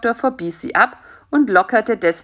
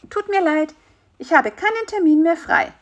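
high phone voice turning someone away; high-pitched, cool professional brush-off; less friendly refusal; 10 semitones higher than usual. A narrator's reading. Practice refuses an appointment by phone, less friendly high voice. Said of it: "klang die Stimme jetzt nicht mehr so freundlich".

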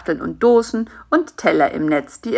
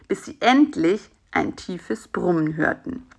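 ordinary delivery, nothing marked

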